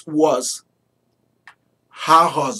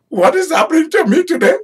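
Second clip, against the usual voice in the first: stunned disbelief; 13 semitones higher, faster than usual. Retold or imitated. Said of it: "And he is".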